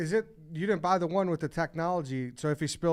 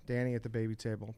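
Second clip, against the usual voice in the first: ordinary talk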